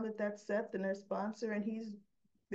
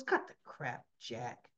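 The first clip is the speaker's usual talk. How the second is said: lower-pitched retold put-down; no-nonsense retort; 8 semitones lower than usual. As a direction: cut him off, unimpressed. Cuts through his small talk.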